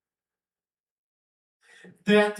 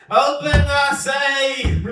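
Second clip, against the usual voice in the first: bellowed prophetic command; commanding shout; 5 semitones higher, 4 dB louder than usual. Acted. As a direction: demand it like a thundering prophet. Prophet demands door open.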